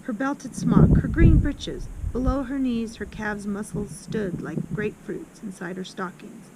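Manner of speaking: plain reading voice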